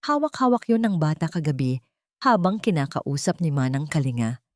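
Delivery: ordinary delivery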